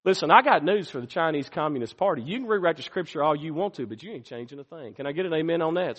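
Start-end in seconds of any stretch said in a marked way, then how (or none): none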